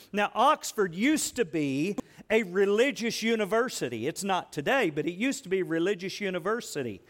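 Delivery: usual speaking voice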